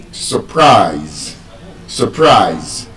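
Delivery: slow surprise, drawn out on -prise; light schwa in sur-, long stressed -prise in surprise; stress on second syllable. Shown as a demonstration. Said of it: "it is stressed on the second syllable".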